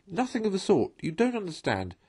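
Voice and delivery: high, loud, cutting in; insistent arguing. Acted. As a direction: talk over the others. Mole arguing over Rat.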